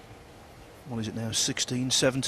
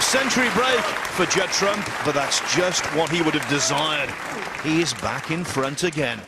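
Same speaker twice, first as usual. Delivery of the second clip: excited commentary; 5 semitones higher, 6 dB louder than usual. Real, not acted. Frame won, commentator raises voice.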